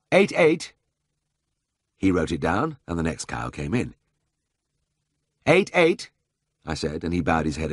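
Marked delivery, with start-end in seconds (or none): 0.1-0.7 high, raised-voice callout; calling out figures. A narrator's reading. Vet calling out measurements to be written. 5.5-6.1 high, raised-voice callout; calling out figures. A narrator's reading. Vet calling out measurements again.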